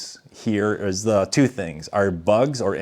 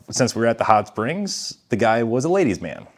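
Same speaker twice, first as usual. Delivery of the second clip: ordinary delivery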